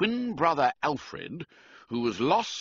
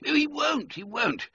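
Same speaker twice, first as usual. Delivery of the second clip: insisting; 14 semitones higher than usual. Acted. Reggie insisting to George.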